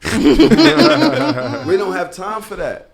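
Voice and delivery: high, loud, slow laughing delivery. Real, not acted. Joking with cohosts, loud and laughing. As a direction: laugh it out, stretching the words.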